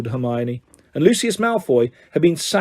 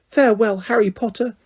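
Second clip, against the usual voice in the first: elf's farewell; 6 semitones higher than usual. A narrator's reading. Elf's tearful goodbye.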